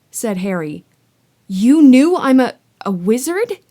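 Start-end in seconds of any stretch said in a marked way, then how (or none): 1.5-3.6 put-on high, loud, disbelieving boy; stunned boy. Harry in disbelief at his uncle, higher and louder. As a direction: gasp it out in disbelief. Harry realizing he's a wizard.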